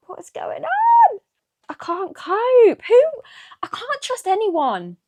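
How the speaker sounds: can't believe the betrayal, crying out; shocked outcry. Real, not acted. Plot betrayal, losing it.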